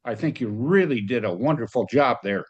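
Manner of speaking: usual speaking voice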